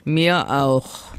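mild, grumbly reply; lightly voiced grumpy hedgehog; grumbling hedgehog. A narrator's reading. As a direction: grumble it back briefly. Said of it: "brummelte der Igel".

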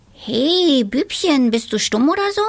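high, loud, teasing witch; cheeky little witch. A narrator's reading. Little witch to the silent boy, loud teasing. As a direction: call out to him, teasing.

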